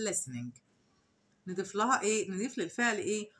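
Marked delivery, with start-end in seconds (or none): 0.1-0.6 low quiet answer. Shown as a demonstration. Teacher softly giving the answer "listening." to students. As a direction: drop low and soft on "listening.". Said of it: "يبقى نقول إيه؟".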